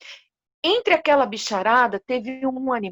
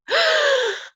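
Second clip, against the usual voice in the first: high shocked gasp; 14 semitones higher than usual. A narrator's reading. News of mother's death.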